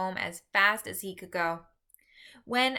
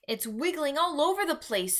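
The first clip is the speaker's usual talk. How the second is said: excited cry; 7 semitones higher than usual. A narrator's reading. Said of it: "Sophie cried".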